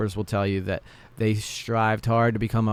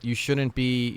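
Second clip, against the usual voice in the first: ordinary delivery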